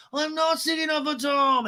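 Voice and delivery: very high mock protest; hammed-up high-pitched denial; Lisa. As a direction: imitate an indignant woman in falsetto. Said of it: "And Lisa's like".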